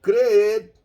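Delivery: "Creed" loud, ending on a long ee with a silent d; Spanish pronunciation. Shown as a demonstration. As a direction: punch "Creed" and let the d vanish. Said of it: "Mira que la maneira". ("Creed" à espanhola, d mudo)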